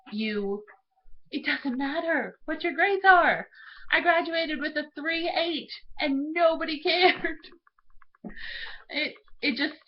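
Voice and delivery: ordinary talk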